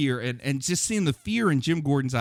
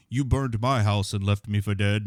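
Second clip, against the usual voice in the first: villain line; 6 semitones lower than usual. Retold or imitated. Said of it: "too on the nose".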